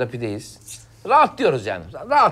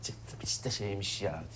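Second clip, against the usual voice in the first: hushed; 7 semitones lower, 16 dB quieter, much slower than usual. Acted. Quietly after extracting.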